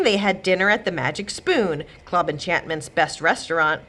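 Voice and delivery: ordinary delivery, nothing marked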